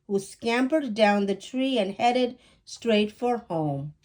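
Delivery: ordinary delivery, nothing marked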